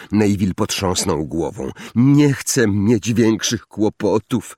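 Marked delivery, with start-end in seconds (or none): none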